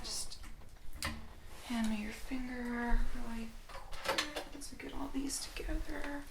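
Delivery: ordinary delivery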